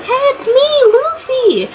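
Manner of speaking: very high, chirpy mock greeting; Luffy. Luffy announcing himself to the Kraken, high imitated voice. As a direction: imitate an eager squeaky-voiced hero. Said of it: "And then he's like".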